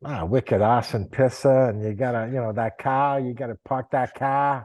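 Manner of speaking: thick Boston accent, dropped r's; hammed-up Boston townie, no r's. Shown as a demonstration. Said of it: "what's your best Boston accent".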